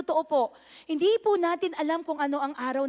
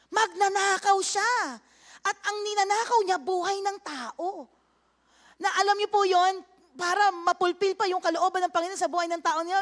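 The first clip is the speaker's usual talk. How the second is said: full-force preaching; 5 semitones higher than usual. Acted. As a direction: preach it hard to the congregation.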